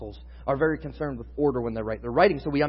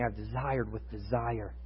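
soft, slow, tender words of longing; tender Jesus quote; 4 semitones lower, 7 dB quieter, slower than usual. Acted. Preacher voicing Jesus to his apostles, soft and tender. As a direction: hush it down like a heartfelt confession. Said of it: "He's saying".

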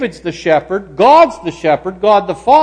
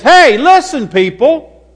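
high, loud voice of God laying down the law; God commanding; 7 semitones higher, 5 dB louder than usual. Acted. God giving the first commandment to the people, commanding. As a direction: call the people to attention, emphatic and raised. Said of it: "he says".

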